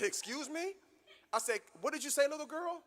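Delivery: a father who can't believe his ears; shocked dad. Retold or imitated. Father shocked at daughter's curse.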